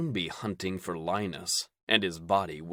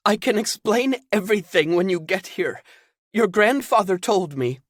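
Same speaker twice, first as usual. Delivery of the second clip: higher, louder worried woman; anxious Zelda; 12 semitones higher, 7 dB louder than usual. Acted. Zelda on the phone hesitating to tell Caden bad news. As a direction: break bad news haltingly.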